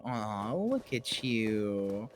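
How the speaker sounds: put-on slow, soft baby-talk coo; mock cooing. Streamer coos at Charlotte like a baby, soft and slow. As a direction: coo at her like a cuddly pet. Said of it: "and I'm going".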